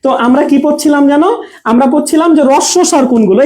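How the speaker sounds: ordinary delivery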